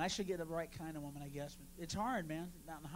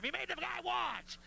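outraged accusing shout ending on watch; mock outraged yell; 10 semitones higher than usual. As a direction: holler it like a scandalized crowd, landing on watch. Imagined crowd yelling accusation.

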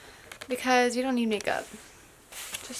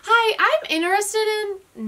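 mock job applicant; 11 semitones higher than usual. Shown as a demonstration. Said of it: "But you say both".

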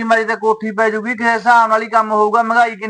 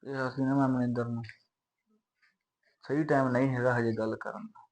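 much softer and lower, keep it private; hushed talk; 7 semitones lower, 15 dB quieter than usual. Acted. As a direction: murmur so mother won't hear. Couple talks privately, hushed. (ਮਾਂ ਤੋਂ ਲੁਕ ਕੇ ਹੌਲੀ ਹੌਲੀ ਗੱਲ)

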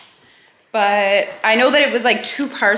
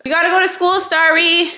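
calling out; 7 semitones higher, 5 dB louder than usual. Real, not acted. Calling child across house.

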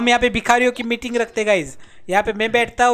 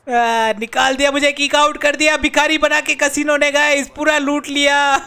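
higher, hammed-up wail; mock wailing; 4 semitones higher than usual. Gamer complains of being kicked out broke, mock wailing. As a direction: lament it like a cheated beggar.

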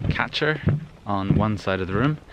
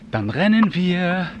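slow, higher chanted reply; children's group answer; 8 semitones higher, 3 dB louder, slower than usual. Retold or imitated. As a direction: chant it back like kids at play. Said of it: "To which the answer came".

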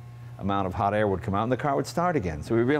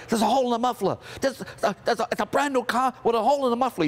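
high-pitched outraged yell; imitated dad screaming; 14 semitones higher, faster than usual. Dad hears his new car's muffler, mock scream. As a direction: holler it high, in disbelief. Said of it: "He screamed".

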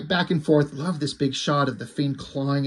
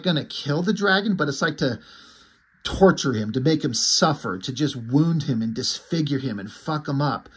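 plain reading voice

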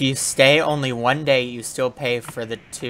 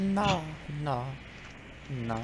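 high, soft, drawn-out no; Consuela; 4 semitones higher, 10 dB quieter, slower than usual. Shown as a demonstration. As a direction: refuse softly and high, like a stubborn maid. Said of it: "to do that accent".

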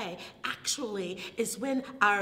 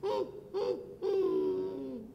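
high, whimpering hums; pitched-up doggy whimper; puppy moan; 7 semitones higher, much slower than usual. Acted. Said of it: "she moans".

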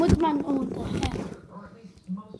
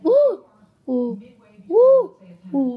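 alternating woo; 6 dB louder, slower than usual. Shown as a demonstration. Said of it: "I can't do that with my voice".

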